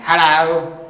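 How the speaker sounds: put-on British accent, called out; British woman's accent. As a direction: mimic a British woman calling hello. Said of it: "she had a British accent".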